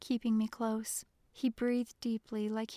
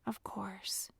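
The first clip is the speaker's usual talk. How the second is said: hushed answer; 2 semitones lower, 8 dB quieter than usual. A narrator's reading. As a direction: answer under your breath. Whispered answer while hiding.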